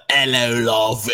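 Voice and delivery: hammed-up Americanized British greeting; mock a Brit with a jokey hello; over-the-top British accent. Mocking how Americans fake British talk, in an over-the-top accent. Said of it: "the whole Americanized version of, like, just super over to the top".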